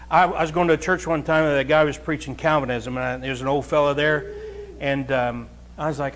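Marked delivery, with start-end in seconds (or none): none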